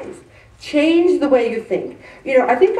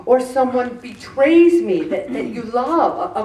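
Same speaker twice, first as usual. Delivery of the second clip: normal talking voice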